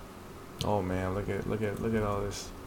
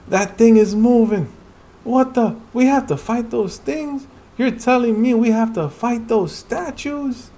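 higher, louder but less frantic; alarmed character voice; 11 semitones higher, 5 dB louder than usual. Acted. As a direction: reread it with disbelief. Same lines reread.

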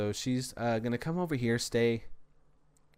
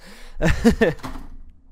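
breathy laugh; 5 semitones higher, 7 dB louder than usual. Real, not acted. Laughs at own rambling.

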